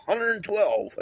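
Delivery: mock astonished outburst; incredulous. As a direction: blurt it out in disbelief. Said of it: "I was like".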